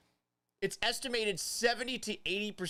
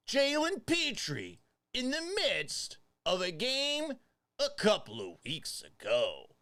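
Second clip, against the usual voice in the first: mock tabloid; 7 semitones higher than usual. Tabloid-style setup.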